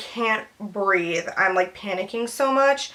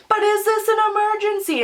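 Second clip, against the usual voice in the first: high-pitched mock-timid question; hammed-up pleading squeak; imitating whiny doubt; 11 semitones higher than usual. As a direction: whine it like a reluctant kid. Acts out own tearful doubt.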